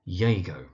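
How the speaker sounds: Iago said YAY-go; wrong Iago, starting like "yay"; mispronunciation. Shown as a demonstration. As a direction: squash Iago into two syllables, YAY-go. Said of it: "you do find mispronunciations such as".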